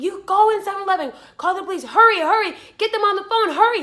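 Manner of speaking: high, loud, hurried orders; urgent command. Retold or imitated. Ordering the man to call police, urgent. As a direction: push him to act, urgently. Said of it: "I was like".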